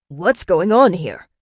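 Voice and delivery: loud, slow demand; shouted. A narrator's reading. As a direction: shout it out across the assembly. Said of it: "another assembly member shouted".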